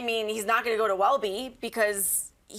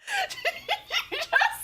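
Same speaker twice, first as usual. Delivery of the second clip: squealing with laughter; high shrieking laugh. Real, not acted. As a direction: burst into a shrill laugh.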